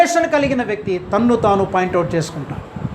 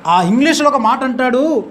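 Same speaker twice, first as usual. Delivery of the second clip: ordinary talk, nothing marked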